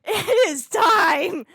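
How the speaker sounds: slow and loud, laughter breaking in; laughing through it. Real, not acted. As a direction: grin through the epic line. Hyped reaction to epic scene.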